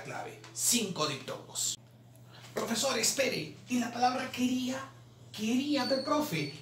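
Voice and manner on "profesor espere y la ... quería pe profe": higher-pitched pupil cutting in; imitated protesting schoolkid; student objecting. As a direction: interrupt like a doubting student. Teacher voices an objecting student.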